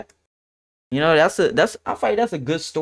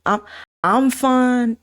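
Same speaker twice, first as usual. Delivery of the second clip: ordinary talk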